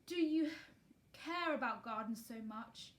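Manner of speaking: slow, wondering question; slowly. A narrator's reading. Guardian slowly asks Mary about gardens. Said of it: "he said slowly".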